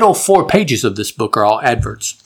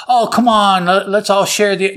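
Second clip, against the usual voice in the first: Doc coaxing them, pitched up, a little whiny; pleading Doc voice; 7 semitones higher than usual. Retold or imitated. Comic book recap, Doc coaxing to share the engine, wheedling. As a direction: wheedle like a greedy old scientist.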